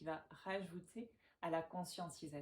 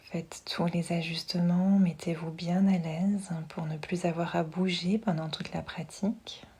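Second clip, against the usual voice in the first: guided meditation; 14 dB louder than usual. Acted. Yoga teacher settling listeners lying down with eyes closed, hushed meditation voice. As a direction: guide them gently into relaxation, unhurried and close.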